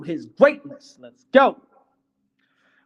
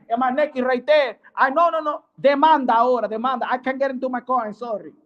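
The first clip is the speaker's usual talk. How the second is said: mock Spanish accent; 6 semitones higher than usual. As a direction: rant in put-on Spanglish as the aggrieved driver. Acts crash victim demanding lawsuit.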